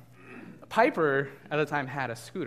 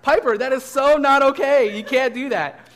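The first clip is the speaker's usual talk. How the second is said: much higher, loud stunned reprimand; shocked scolding; 11 semitones higher, 16 dB louder than usual. Retold or imitated. Babysitter scolding the little girl who won't share, loud and shocked. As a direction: scold her in stunned outrage. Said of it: "like, shocked".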